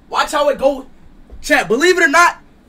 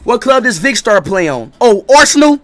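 mocking voice memo; 2 semitones higher, 5 dB louder than usual. Streamer recording a taunting voice memo, loud and mocking. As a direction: call it out, then pitch up in disbelief. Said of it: "just do a voice memo".

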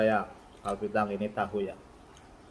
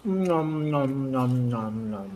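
nom nom chomping; 3 semitones higher, much slower than usual. Real, not acted. Eating sounds in mukbang.

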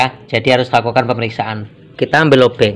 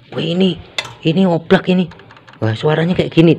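higher-pitched mock concern; imagined mistaken diagnosis; 4 semitones higher than usual. Mechanic mimics a viewer misjudging loose parts, higher mock concern. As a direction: act out someone jumping to a wrong conclusion. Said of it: "Nanti jangan Anda anggap".

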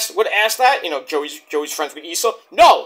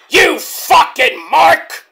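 loud, slow, higher-pitched heckle; MJF; 7 semitones higher, 6 dB louder, much slower than usual. Retold or imitated. Wrestler insulting a fan, loud slow put-on yell. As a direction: belt out each word, drawn out and scornful. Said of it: "as M J F would say".

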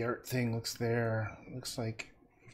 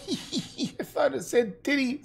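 laughing; 14 semitones higher, slower than usual. Real, not acted. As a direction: laugh it out much higher with real amusement. Misreads viewer name, laughs.

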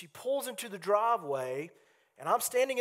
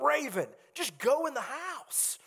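exasperated; 8 semitones higher than usual. Retold or imitated. Exasperated third order to teen.

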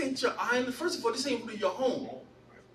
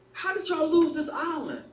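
mildly incredulous, pitched up; incredulous question; 6 semitones higher than usual. Retold or imitated. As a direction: ask it in disbelief at your own people. Acts out disbelief at his people.

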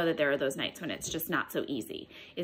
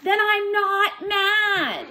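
much higher, louder, slow yell; slow furious shout; exasperated shout; 13 semitones higher, 7 dB louder, much slower than usual. Acted. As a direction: bellow it to end the argument. Exasperated kid yells at monster.

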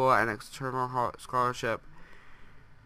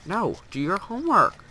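higher, louder nagging, like a fed-up mom; mock exasperated scolding; 9 semitones higher, 5 dB louder than usual. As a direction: play the fed-up parent, pushing higher. Nagging her Sim about homework.

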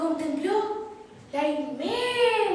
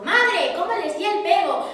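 proud boast; 5 semitones higher, 7 dB louder than usual. Acted. Juan boasting to his mother.

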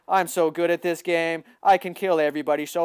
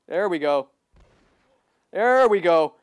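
ordinary talk, nothing marked

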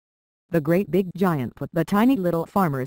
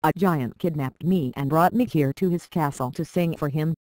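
plain reading voice

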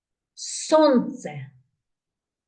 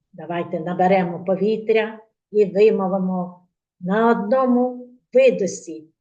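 usual speaking voice

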